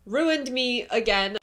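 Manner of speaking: loud laugh through tears, slowing; gush, half laughing, half crying; loud teary gush over the book; emotional. Real, not acted. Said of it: "I'm an emotional reader".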